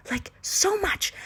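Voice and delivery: ordinary talk, nothing marked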